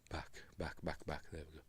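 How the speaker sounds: whispered under the breath. Real, not acted. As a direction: whisper it to yourself. Muttering while fixing the stream.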